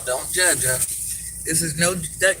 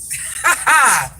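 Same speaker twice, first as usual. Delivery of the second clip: loud real laugh, falling away; burst of laughter; 6 semitones higher, 5 dB louder than usual. Real, not acted. Granny on her live laughing at the children who lied. Laughing at kids' permanent marker story.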